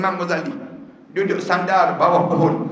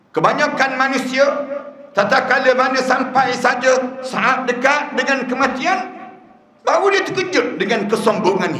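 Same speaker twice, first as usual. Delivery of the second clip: higher, intense preaching voice; fervent preaching; 7 semitones higher than usual. Acted. Preacher warns the congregation about arrogance, fervent and raised. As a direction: drive the warning home with fire.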